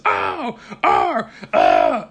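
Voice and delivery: high whimpering oh, oh; hammed-up sulky whimper; mock sulky whimper. Daughter sulking in messages.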